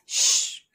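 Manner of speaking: bare "sh", no vowel; weak form of 'she'. Shown as a demonstration. Teacher showing learners the weak form of she, just "sh". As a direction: hold only the "sh" sound. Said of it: "The weak form of she is".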